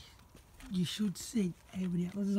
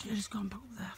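hushed urgent whisper; whispering to a friend in the dark; 7 dB quieter than usual. Real, not acted. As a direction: whisper so no one hears. Sneaking apples at night.

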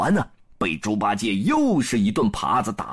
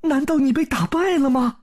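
princess worried; 12 semitones higher than usual. A narrator's reading. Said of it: "赶紧跑出来说道".

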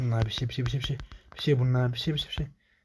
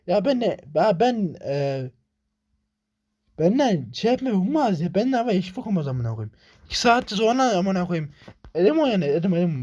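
frustrated ranting; 5 semitones higher than usual. Real, not acted. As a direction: vent at the game. Continued frustration at game.

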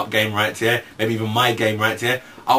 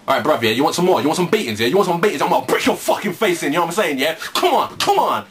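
thug taunt; 5 semitones higher than usual. Pretend. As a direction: square up and goad them. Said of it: "I'll be like this".

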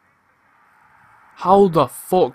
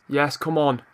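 ordinary delivery

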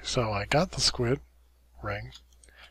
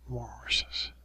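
weary whisper; 6 dB quieter than usual. Real, not acted. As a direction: whisper it in defeat. Whispered disappointment.

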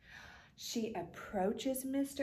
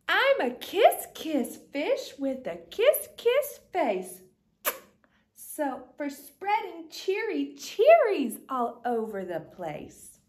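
loud, bursting with delight; louder, overjoyed fish smooching everyone; joyful kiss fish; 2 semitones higher, 8 dB louder than usual. Acted. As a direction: throw out the happy news. Fish joyfully discovers he's kiss fish.

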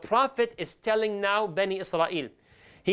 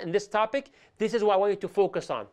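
ordinary talk, nothing marked

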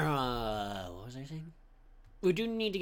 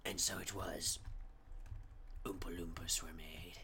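mock scripture narration; 6 semitones lower than usual.

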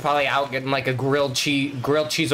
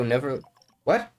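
ordinary talk

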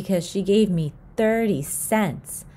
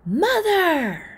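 high, loud cries for mom; calling out; 4 dB louder, much slower than usual. Acted. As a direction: yell for mom desperately.

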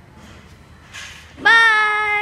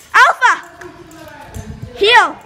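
calling out; 11 dB louder, slower than usual. Real, not acted. Owner calling her dog Alpha back to heel, loud shout. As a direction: call him back from far off.

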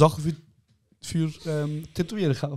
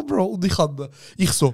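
ordinary delivery

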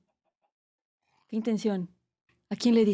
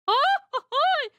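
mariachi grito; much slower than usual. Shown as a demonstration. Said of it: "Así como grito marachi".

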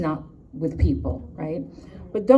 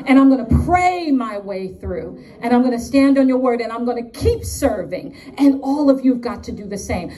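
emphatic, defiant declaration of faith; fervent preaching; 5 semitones higher, 4 dB louder than usual. Real, not acted. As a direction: preach it with forceful punches on key words. Preaches her declaration of trust.

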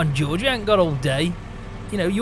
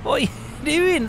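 calling out; 9 semitones higher than usual. Real, not acted. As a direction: yell it out to the driver ahead. Calling out to slow scooter driver.

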